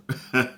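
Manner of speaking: a real chuckle breaking out; burst of laughter. Real, not acted. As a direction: let a short laugh escape. Laughing at own retelling.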